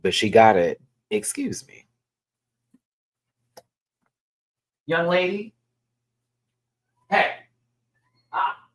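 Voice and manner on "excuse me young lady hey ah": scolding the cat. Real, not acted.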